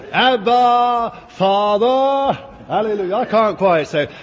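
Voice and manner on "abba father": "Abba, Father" raised high and lifted, the Swedish way; Swedish way of saying it. Shown as a demonstration. Preacher showing the congregation how the Swedes say it, raised and ringing. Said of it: "That's how they say it".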